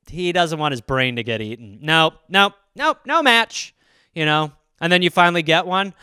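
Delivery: ordinary delivery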